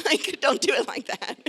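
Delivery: laughing through it, high; real laugh, breaking high. Real, not acted. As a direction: crack up at your own joke. Laughing at own example.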